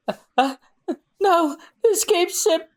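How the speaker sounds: very high, faint-hearted dismay; stunned despair. Acted. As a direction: stammer in high-pitched horror. Crew sees ship blown up.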